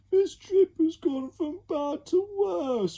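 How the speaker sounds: high, slow whine; whining. Acted. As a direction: spoilt child whining. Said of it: "whined another".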